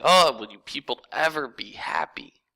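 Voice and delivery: slow whispered sigh of a fed-up king; a king muttering in disbelief at his people; exasperated whisper. Retold or imitated. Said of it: "It's like".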